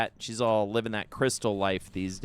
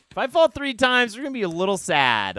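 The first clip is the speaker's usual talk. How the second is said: nervous excitement; 11 semitones higher, 8 dB louder, faster than usual. Real, not acted. Dreading a third fall.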